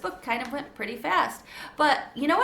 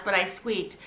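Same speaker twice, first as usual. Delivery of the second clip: ordinary delivery, nothing marked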